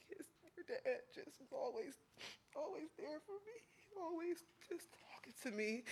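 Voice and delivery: choking up while thanking the family; holding back tears. Real, not acted. Son at his father-in-law's funeral, voice breaking. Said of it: "this just is a lot for me".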